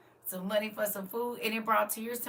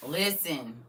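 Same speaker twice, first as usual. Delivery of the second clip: ordinary talk, nothing marked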